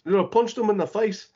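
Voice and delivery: high-pitched, badly faked Kiwi accent. Wrestler Fale on commentary, imitated in a bad Kiwi accent. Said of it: "That was my bad Kiwi accent".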